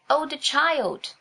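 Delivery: high, slow wailing cry; shriek. A narrator's reading. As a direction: wail for the lost child. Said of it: "shrieked the maids".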